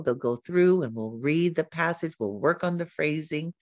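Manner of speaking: normal talking voice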